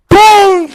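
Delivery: un "pum" gritado, alto y fuerte; golpe imitado. Retold or imitated. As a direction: suelta el golpe con la voz. (onomatopeya de golpe gritada)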